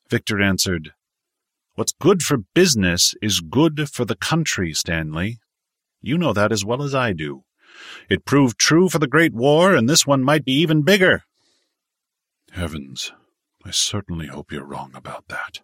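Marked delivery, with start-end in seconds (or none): 1.8-11.2 insistent businessman, pitched up and louder as he builds; forceful tycoon. A narrator's reading. Victor answers Stanley that war is good for business, forceful. 12.5-15.6 put-on low, soft, dismayed older man; worried elder. A narrator's reading. Stanley hopes Victor is wrong about a bigger war, low and worried.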